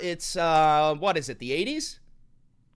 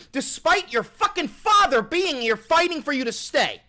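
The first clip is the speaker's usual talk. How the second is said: much higher and louder outburst; furious peak; 8 semitones higher, 5 dB louder than usual. Real, not acted. Streamer confronting chatter Vincent, furious high shout. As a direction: let it explode on the last clause.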